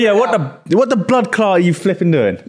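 high-pitched indignant question in English-tinged patois; patois slipping into English. Pretend. Learner cursing a driver, patois slipping into English accent. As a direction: protest in a half-English attempt at patois. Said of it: "the flipping switches it to English".

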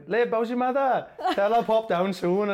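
high, slow chatty Welsh mum; friendly Welsh mum. Retold or imitated. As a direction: call out warmly like a local auntie.

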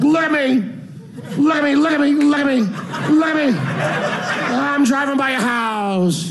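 high, hammed-up calling out, slowing at the end; mock showing off. Motorcyclist begging for attention outside the house, hammed-up calling. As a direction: crow it like a show-off on a bike.

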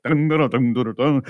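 rapid sung-out news jingle imitation; news sounder imitation. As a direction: mimic a radio news sting with your voice. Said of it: "the sounder thing, and it's like".

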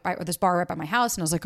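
usual speaking voice

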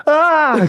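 short high-pitched "ah"; retold high exclamation; imitated cry. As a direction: mimic her sudden high yelp. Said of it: "she'd never had sex before".

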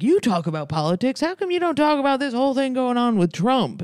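high-pitched, pestering demand; high, hectoring impression of a badgering man. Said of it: "[name] was being like".

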